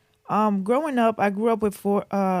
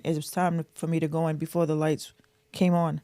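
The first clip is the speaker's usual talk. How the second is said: normal talking voice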